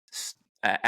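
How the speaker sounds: usual speaking voice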